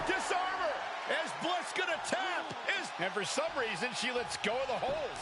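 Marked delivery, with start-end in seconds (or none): 0.1-0.8 excited shout. Real, not acted. Repeated call.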